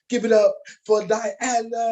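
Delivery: high, loud crowd hype-up; hype announcer call. Real, not acted. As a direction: pump up the room for the poet. Host introduces next poet.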